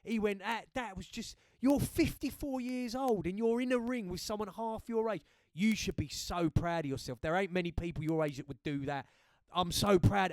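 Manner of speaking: loud, high, heartfelt cheering on; proud excited praise. Retold or imitated. Best friend ringing to say how proud he is, loud and delighted. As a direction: gush with pride over your mate.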